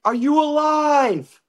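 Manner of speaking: high, slow call into the valley; drawn-out shout to someone far below. Retold or imitated. Stranger on the pass calls down to the wrecked car, slow high call. Rescuer calling down from road.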